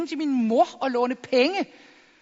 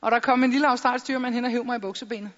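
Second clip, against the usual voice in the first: ordinary delivery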